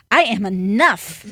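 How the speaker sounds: a coach showing how to mean it; with intensity. Shown as a demonstration. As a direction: declare it with your whole self. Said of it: "You say".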